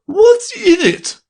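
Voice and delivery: high, slow, loud shriek; queen screeches at the rabbit, high and loud. A narrator's reading. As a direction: screech the demand. Queen demands the paper.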